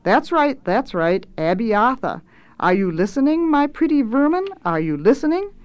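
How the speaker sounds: lightly voiced lordly cook, pitch swooping; pompous, teasing cook; patronising chef. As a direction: check they are listening, then demand. Swelter quizzes the apprentices.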